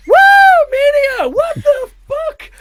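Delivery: shrieked high whoop sliding into bewilderment; frantic mock cheer. Shaken comedian faking event hype, frantic mock cheer.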